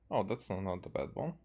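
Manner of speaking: ordinary talk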